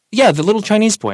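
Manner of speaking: imitating an oblivious customer, high and loud; blithe confirmation. Customer repeating his description to the worker, blithely.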